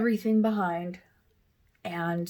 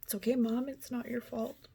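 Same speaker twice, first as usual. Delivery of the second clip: soft comfort; 3 semitones higher, 7 dB quieter than usual. Retold or imitated. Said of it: "she said".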